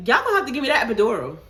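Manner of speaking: fast, loud begging; rushed plea. Retold or imitated. Frantic mother begging the nurses fast for the epidural. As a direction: blurt it out in a panic.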